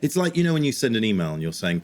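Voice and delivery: ordinary talk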